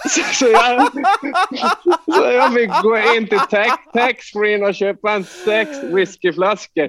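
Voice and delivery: high, laughing through the words; can't keep a straight face, slowing at the end; laughing while telling. Real, not acted. Player to host, laughing at his own story.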